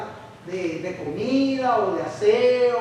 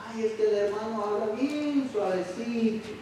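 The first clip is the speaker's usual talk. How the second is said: lightly voiced whiny churchgoer; a hint of a fussy complaint; whiny complainer; 4 dB quieter than usual. Churchgoer grumbling about the soft-spoken brother, mildly whiny.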